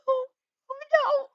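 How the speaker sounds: high wailing no; real dismay; anguished no. Real, not acted. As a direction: cry out as the feather is lost.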